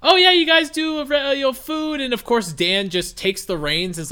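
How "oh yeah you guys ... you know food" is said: higher, louder dismissive mock voice. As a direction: shrug it off like nobody cares about the topic.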